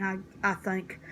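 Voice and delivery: ordinary delivery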